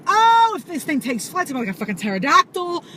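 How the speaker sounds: high, loud and rushed; terrified of the flying bug; panicked. Retold or imitated. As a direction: dread it taking flight. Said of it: "I'm like".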